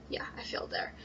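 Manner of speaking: soft unvoiced admission of a slip; whispered aside. Real, not acted. Reciter whispering after fumbling a line. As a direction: whisper it to yourself.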